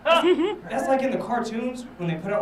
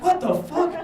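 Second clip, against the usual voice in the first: loud, high-pitched shock; startled outburst; 11 semitones higher, 5 dB louder, slower than usual. Acted. Stoner startled by someone next to him, high loud yelp. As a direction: blurt it as if someone just made you jump.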